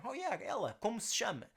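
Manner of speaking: high, quick casual question; casual gossip. Pretend. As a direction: ask about a girl across the room, breezily. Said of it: "that girl over there".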